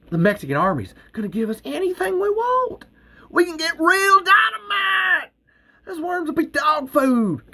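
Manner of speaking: high, loud eager push; excited pleading. Acted. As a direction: sell the dream to Earl. Val pushing Earl, elated.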